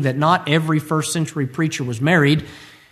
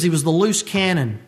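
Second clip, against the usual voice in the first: usual speaking voice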